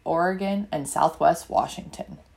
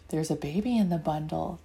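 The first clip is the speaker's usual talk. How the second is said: put-on softer and lower, so the baby sleeps; hushed; 6 semitones lower, 6 dB quieter, faster than usual. Children looking at the sleeping baby, hushed and softer. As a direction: hush it, don't wake her.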